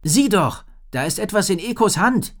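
put-on high, loud girl's voice; girl urging her friend to look; Rory, urgent. A narrator's reading. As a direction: point it out eagerly. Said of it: "sagte Rory".

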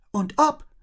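excited mouse blurting out; blurted. A narrator's reading. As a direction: burst out with it. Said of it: "plapperte Olaf los".

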